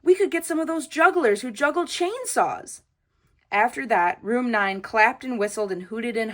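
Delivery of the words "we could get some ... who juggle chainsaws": excited shout. A narrator's reading. Paulie keeps shouting his idea.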